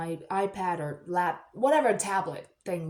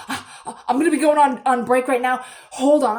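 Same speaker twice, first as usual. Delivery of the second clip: breathless starts, then loud and higher; freaking out; 4 semitones higher, 9 dB louder than usual. Retold or imitated. Acquaintance desperate to see the pythons, loud and flustered. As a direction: imitate a flustered, excited outburst. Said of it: "She's, like, freaking out".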